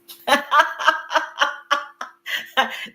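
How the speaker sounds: bright burst of high laughter at the good news; relieved giggle; delighted high laugh. Real, not acted.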